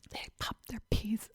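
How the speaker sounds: soft ASMR whisper; breathy whisper into the mic. Pretend. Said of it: "I don't have the A S M R voice".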